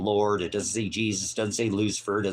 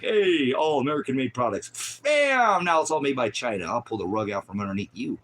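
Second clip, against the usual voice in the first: mock huckster; 6 semitones higher, 5 dB louder than usual. Mocking rug-pulling false preacher.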